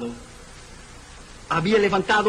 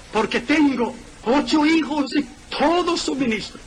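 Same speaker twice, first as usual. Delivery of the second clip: fervent prayer; 8 semitones higher than usual. Retold or imitated.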